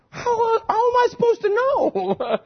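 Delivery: very high, drawn-out comic whine; mock wailing. Clueless husband protesting to his wife, mock wailing. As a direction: wail it slowly in pretend helplessness.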